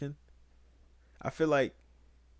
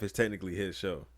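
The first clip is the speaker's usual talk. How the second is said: normal talking voice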